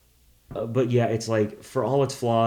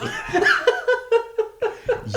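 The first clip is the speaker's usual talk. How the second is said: loud laugh; 3 dB louder than usual. Real, not acted. Laughing at joke.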